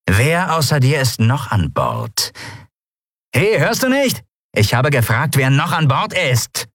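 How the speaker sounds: slow, demanding, impatient; menacing pirate. A narrator's reading. The pirate interrogates the frozen boy, impatient and menacing. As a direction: press him for an answer.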